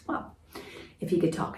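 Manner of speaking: ordinary delivery, nothing marked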